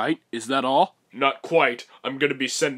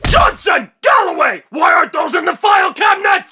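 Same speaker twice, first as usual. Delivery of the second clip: furious yelling boss; 13 dB louder than usual. Acted. Boss explodes yelling at staff.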